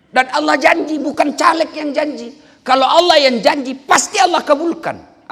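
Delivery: full-force preaching. Acted. Preacher rouses the audience about knowledge. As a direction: drive it home to the crowd.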